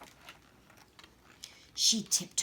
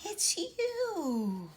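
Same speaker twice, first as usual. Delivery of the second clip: awed surprise; 14 semitones higher than usual. Acted. Gracie meets Santa.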